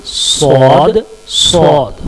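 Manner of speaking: स्वाद with a thick, heavy s; letter demo. Shown as a demonstration. Teacher reminding students of the heavy letter, thick s. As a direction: say स्वाद with a full, heavy hiss. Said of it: "उस आवाज़ का आप ख्याल रखें".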